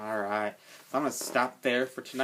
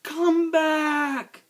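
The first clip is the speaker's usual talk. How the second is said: long, high, desperate shout; 9 dB louder than usual. A narrator's reading. Girl to rabbit falling into the sea, shouted. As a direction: cry out loudly to someone far away. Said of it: "she shouted".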